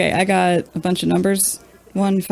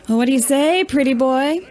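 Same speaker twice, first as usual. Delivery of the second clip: taunting; 6 semitones higher than usual. Acted. Taunting rival at dice.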